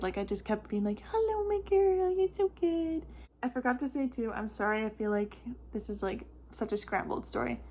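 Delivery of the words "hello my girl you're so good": high-pitched, cooing cheer; sing-song sweet talk to a baby; reassuring baby talk. Retold or imitated. Mom hiding worry from Raylan, reassuring baby talk. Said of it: "I don't think Raylan knew I was worried".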